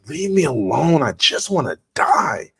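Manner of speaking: high, drawn-out mock protest; retold exasperated plea. Patient fending off the doctor's questions, exasperated. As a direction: plead to be left alone, voice swooping up.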